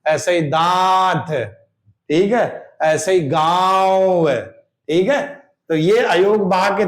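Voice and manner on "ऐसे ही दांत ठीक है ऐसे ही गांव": very slow दांत and गांव with nasal vowels; teacher gives more nasal examples; nasalized vowel demo. Shown as a demonstration. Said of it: "नाक से भी जोर पड़ रहा".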